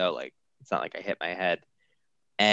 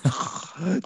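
exaggerated fake snore; mock snoring; 9 semitones higher than usual. Husband putting on a comedic show, mock snoring. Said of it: "giving a loud snoring noise".

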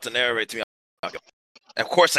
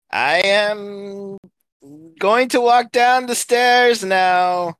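slow grand declaration; 7 semitones higher, much slower than usual. Acted.

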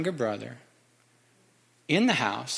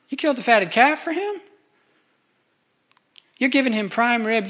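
resentful complaint; 7 semitones higher than usual. Acted. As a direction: whine out every grievance, pitch climbing. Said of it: "the older son says this".

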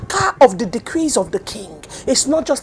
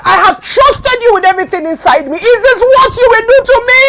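crying out to God, voice raised and strained; a desperate plea, pushed higher and louder, near tears; anguished cry to God; 5 semitones higher, 6 dB louder than usual. Retold or imitated. Preacher retells crying out to God.

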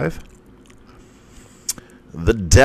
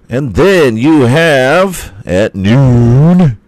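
drawn-out announcer tease; 7 semitones higher, 9 dB louder, much slower than usual. Pretend. Drawn-out announcer build-up of matchup.

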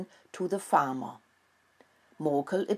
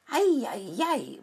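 high, squeaky ay-ay-ay; disgusted squeal; 7 semitones higher than usual. A narrator's reading. Mouse child squeals as the rat scratches dirt everywhere. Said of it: "Mocolini squealed".

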